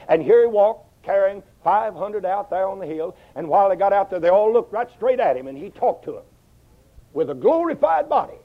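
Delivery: rising preaching fervor. Acted. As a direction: lift the congregation toward the glorified body. Preaching at full force.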